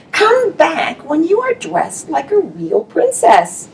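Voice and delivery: a vain prince scolding the princess; snooty prince. Acted. Prince voice in read story.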